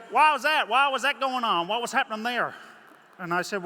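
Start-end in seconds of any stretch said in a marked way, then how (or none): 0.1-2.5 much higher and louder American twang; imitated American gentleman. The American man pressing him about limping. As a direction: push the questions high and loud like the drawling stranger. Nosy gentleman's questions retold.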